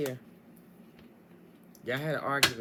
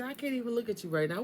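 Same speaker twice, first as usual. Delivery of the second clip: mock pissed-off; 7 semitones higher, faster than usual. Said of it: "He is pissed off at you".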